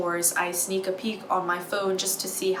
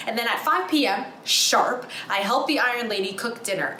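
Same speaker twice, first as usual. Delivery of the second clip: ordinary talk, nothing marked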